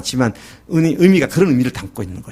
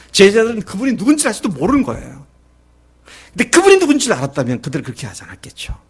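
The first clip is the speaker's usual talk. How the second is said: normal talking voice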